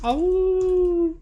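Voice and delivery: high yipping howl; howling like a wolf pup. Pretend. Howl again.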